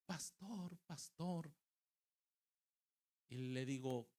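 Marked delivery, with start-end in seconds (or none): 0.1-1.5 higher, softer imitation of a child; lightly voiced boy calling for help; child calling pastor. Boy interrupting the pastor's preaching, calling out softly. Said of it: "de momento llegó un niño".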